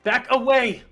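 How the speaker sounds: shouted warning. Acted. Theodore facing mob.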